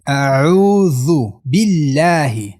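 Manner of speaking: slow, stretched 'اعوذ بالله' with clear bi; clear kasra. Shown as a demonstration. Teacher models the clear kasra, slow chant. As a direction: recite 'اعوذ بالله' slowly, i vowels distinct.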